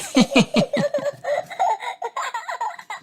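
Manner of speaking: long, soft high-pitched giggle. A narrator's reading. Peppa after her burp, giggling. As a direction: giggle softly in a tiny high voice.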